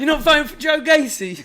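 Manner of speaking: high, loud Scouse outrage; mock-furious Liverpool shout, half laughing; angry Scouse. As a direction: yell it as an affronted Scouser. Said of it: "he comes out here all angry at him".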